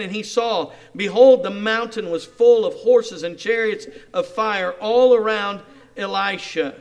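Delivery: loud, fervent reading of the prayer; full-force preaching. Acted. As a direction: proclaim the verse to the crowd. Reads scripture at full force.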